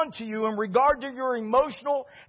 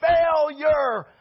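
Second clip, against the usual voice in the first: whiny, drawn-out cry of failure; mock despairing wail; 3 semitones higher, slower than usual. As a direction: wail it like a beaten man. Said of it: "And he's like".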